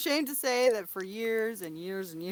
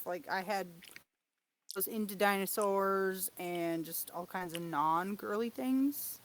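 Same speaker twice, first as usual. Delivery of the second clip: ordinary talk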